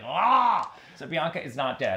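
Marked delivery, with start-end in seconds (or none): 0.0-0.7 higher, laughing little shriek; mock shriek. Imagining Bianca's hate mail, mock shriek. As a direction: squeal it in jest. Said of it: "It's like".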